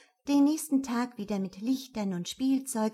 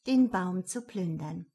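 plain reading voice

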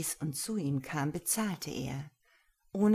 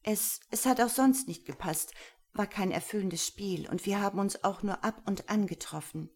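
put-on higher, hesitant young voice; nervous stammering woman; 4 semitones higher than usual. Lena explains her past to Gero, nervous. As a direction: stumble through it nervously.

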